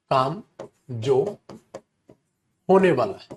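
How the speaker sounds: word by word with long pauses; spaced-out teacher dictation; dictation pace. Shown as a demonstration. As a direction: dictate it in separate chunks for students to write.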